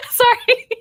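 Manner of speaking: high laughing apology. Real, not acted. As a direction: giggle out a sorry.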